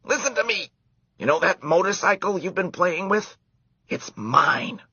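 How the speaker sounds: high, loud squeaky insistence; as loud as he could. A narrator's reading. Mouse claiming his motorcycle from the boy, loud squeak. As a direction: push it out as loud as a mouse can. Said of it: "as loud as he could".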